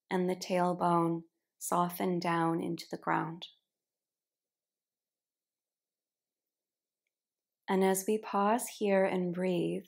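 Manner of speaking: unhurried meditation cueing, letting each phrase settle; guided relaxation. Real, not acted. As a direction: guide them gently into rest, phrase by phrase. Guides relaxation at the start of yin yoga.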